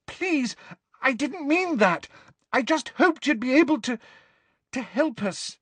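high, squeaky, faltering plea; Hermione, horror-struck. Acted. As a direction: backtrack in a frightened high voice. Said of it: "in a horror-struck squeak".

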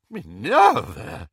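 loud, drawn-out shocked exclamation; aghast. A narrator's reading. Slughorn hears a threat of a duel, aghast. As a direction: stretch the name in horror. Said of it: "he said, aghast".

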